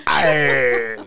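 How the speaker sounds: slow, lightly voiced pirate. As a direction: ham it up like a pirate. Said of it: "you sound like a pirate".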